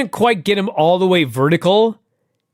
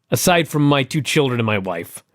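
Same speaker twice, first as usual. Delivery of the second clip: ordinary talk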